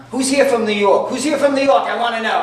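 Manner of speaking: loud, high eager tourist calling out; hammed-up eager out-of-towner. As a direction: call out like a pushy tourist in line. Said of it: "then you get the people in line".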